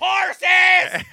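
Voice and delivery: very high, loud shouted horses; hammed-up shout. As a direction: bellow it like a megaphone host. Parody of show shouting.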